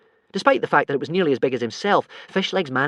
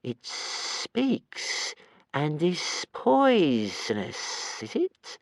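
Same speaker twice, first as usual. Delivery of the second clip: slow sinister creature; 4 semitones higher, much slower than usual. Acted. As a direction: drawl it out slyly. Strangulator voiced in story.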